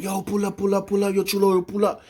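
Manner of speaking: high, insistent summons in retelling; urgent calling. Retold or imitated. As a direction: imitate someone eagerly calling a friend over. Retold urgent call from next cell.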